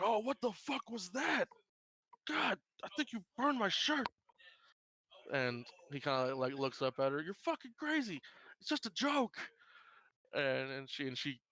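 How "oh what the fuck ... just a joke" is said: high whiny voice, much higher, stung and indignant; whiny prankster. Acted. Tased cook whining at the server in high whiny voice. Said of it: "He's like".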